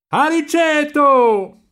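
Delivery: very high, long-drawn holler; full-lung shout. A narrator's reading. Marcello calls his friend in the river, full-lung high shout. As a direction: call with all the breath in your throat. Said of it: "gridava Marcello con quanto fiato aveva in gola".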